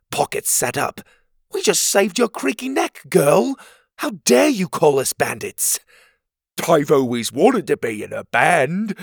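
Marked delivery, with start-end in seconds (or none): 1.5-5.8 indignant. Acted. Pocket outraged at accusation. 6.7-9.0 a dreamy, louder odd creature voice; dreamy Petey. Acted. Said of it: "Petey said dreamily".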